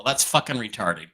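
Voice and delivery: usual speaking voice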